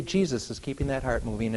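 ordinary talk, nothing marked

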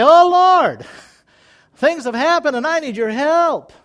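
loud, high pleading cry to the Lord; urgent prayer. Retold or imitated. Believer running to God, loud and pleading. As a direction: cry out for help. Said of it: "run fast to him and say".

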